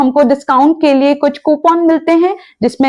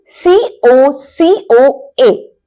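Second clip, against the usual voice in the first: letter by letter, spaced and slow; slowly spelt letters; slow spelling; 2 semitones higher, much slower than usual. Shown as a demonstration. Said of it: "spelling the word".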